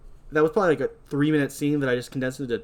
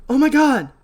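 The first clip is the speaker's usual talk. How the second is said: high, loud frightened cry; startled scream; 10 semitones higher, 8 dB louder than usual. Acted. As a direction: jump out of your skin. She screams and turns around.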